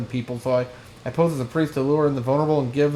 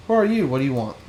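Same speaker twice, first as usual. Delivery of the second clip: normal talking voice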